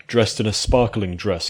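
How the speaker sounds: ordinary delivery, nothing marked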